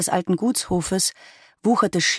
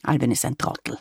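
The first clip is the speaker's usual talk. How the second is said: a softer, low grumble; grumbled; 4 semitones lower, 4 dB quieter than usual. A narrator's reading. Grandfather dismisses Alwin, grumbling softly. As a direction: mutter it under the breath, grumpy. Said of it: "sagte Opa Sepp gebrummt".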